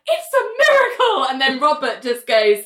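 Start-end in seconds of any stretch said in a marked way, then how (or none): 0.1-1.2 high, breathless wonder; awed nurse. Retold or imitated. The nurse marvels as the patient wakes, awed. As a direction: gasp it like you've seen a miracle.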